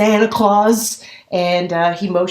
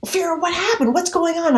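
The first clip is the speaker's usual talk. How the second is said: alarmed mom; 8 semitones higher than usual. Retold or imitated. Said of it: "My mother goes".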